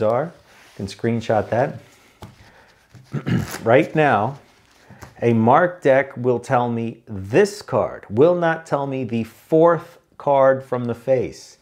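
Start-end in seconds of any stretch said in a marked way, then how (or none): 5.2-11.6 slow, pointed explanation; unhurried, stressing each key word; deliberately slow. Shown as a demonstration. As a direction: talk slowly so they finally get it. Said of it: "you gotta talk slow".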